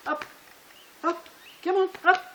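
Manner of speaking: high, excited call to the dog; coaxing dog call. Real, not acted. Trainer calling his dog to jump up on him, high and excited. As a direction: coax the dog up with a high, eager voice.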